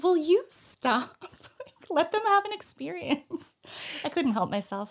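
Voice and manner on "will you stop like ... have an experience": husband's plea, pitched up higher and slowed; lightly voiced husband begging her to stop spoiling; exasperated husband. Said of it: "My husband was like".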